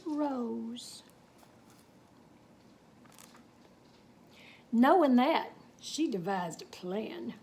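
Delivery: unhurried Texas tall-tale telling; story narration. A narrator's reading. Reading a cowboy tale to children, storytelling. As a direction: spin the yarn for the kids.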